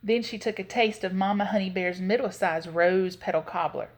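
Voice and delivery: ordinary delivery, nothing marked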